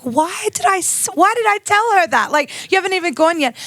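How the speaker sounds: high, exasperated voice, scolding herself; self-scolding. Retold or imitated. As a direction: kick yourself, half laughing at your own blunder. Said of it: "I can remember kicking myself".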